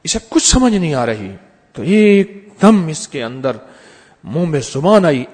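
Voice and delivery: ordinary talk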